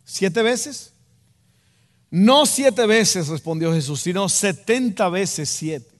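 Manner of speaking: ordinary talk, nothing marked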